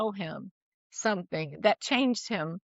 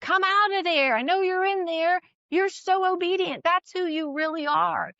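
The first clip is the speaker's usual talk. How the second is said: higher, raised voice calling someone out of the tomb; mother calls her son's true self out, like Lazarus, raised call; 8 semitones higher than usual. Retold or imitated. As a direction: summon him out, strong and sure. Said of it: "like calling Lazarus out".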